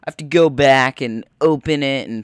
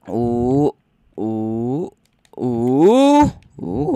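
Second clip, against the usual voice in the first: thrilled cooing; much slower than usual. Real, not acted. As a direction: coo with growing delight. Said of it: "I'm getting tingly".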